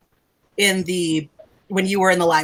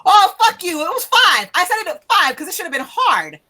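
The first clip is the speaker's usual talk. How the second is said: high, loud mock-furious laughing yell; hammed-up indignation, ending in a laughing shout; mock outrage; 8 semitones higher, 4 dB louder than usual. Real, not acted. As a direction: playfully blow up at the player's lucky roll. Player's roll beats the GM.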